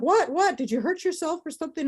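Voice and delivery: high, quick alarmed question; alarmed family. Retold or imitated. Family alarmed at her crying.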